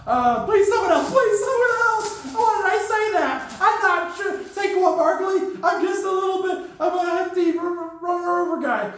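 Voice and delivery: high, pleading, whiny player voice. Retold or imitated. Drafted player begging the Raiders to take someone else, high whine. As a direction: beg the team to pick someone else.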